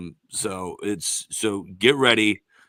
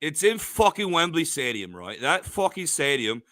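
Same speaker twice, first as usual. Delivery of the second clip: put-on British accent; 3 semitones higher than usual. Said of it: "Joseph and I can talk a British accents".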